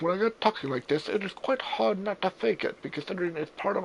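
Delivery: high-pitched mock British accent; fake British accent. Friend quoting Nigel defending his accent, mock British accent. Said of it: "did his British accent".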